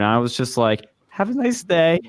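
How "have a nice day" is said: high-pitched retold nervous nicety; mock-meek, pitched-up farewell; scared politeness. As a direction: act out a frightened, polite brush-off. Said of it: "I was, like, speechless and scared".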